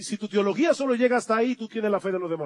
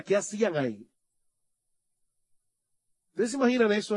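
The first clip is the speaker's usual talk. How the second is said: usual speaking voice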